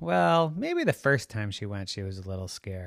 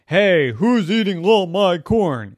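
loud, gruff-free shout of a mock farmer; pretend farmer; 8 semitones higher, 14 dB louder than usual. Brother pretending to be the farmer, loud mock shout. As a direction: brother playing an angry farmer to scare them. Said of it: "pretending he was the farmer".

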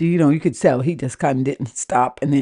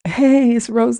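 higher-pitched imitation of a man on the phone; put-on higher caller voice; Rose; 8 semitones higher, 3 dB louder, slower than usual. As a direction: mimic his phone greeting in a raised voice. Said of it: "Rose was like".

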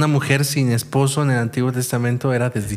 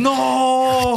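anguished cry; 10 semitones higher than usual. Acted. Father sees daughter come out.

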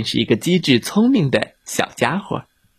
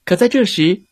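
ordinary delivery, nothing marked

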